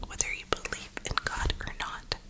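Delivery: usual speaking voice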